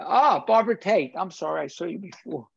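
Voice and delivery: ordinary talk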